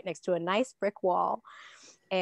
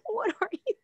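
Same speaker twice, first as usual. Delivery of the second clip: high, laughing disbelief; baffled partner; 13 semitones higher than usual. Retold or imitated. Partner reacting to floor spinebuster, high laughing bafflement. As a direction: ask it half laughing, stunned. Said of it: "And she's like".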